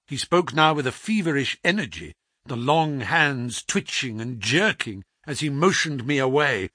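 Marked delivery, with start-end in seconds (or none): none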